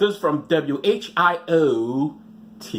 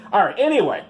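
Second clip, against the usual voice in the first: ordinary talk, nothing marked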